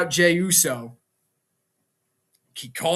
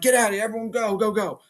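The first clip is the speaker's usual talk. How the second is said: tag; 5 semitones higher, faster than usual. Retold or imitated. Official ordering everyone out, acted.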